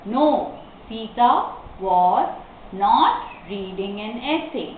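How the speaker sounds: slow dictation, pauses after each word. Shown as a demonstration. Teacher explains the comma after no, word by word. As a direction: break the answer into pieces.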